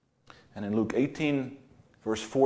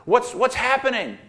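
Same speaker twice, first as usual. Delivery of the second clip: a blind man calling out high and loud, confused; 11 semitones higher, 6 dB louder than usual. Retold or imitated. Blind man asks the crowd what's happening, calling out loud. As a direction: shout it out, lost and unsure. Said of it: "he called out and said".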